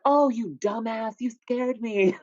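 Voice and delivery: slow, high scolding mom; relieved mom. Retold or imitated. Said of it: "And she's like".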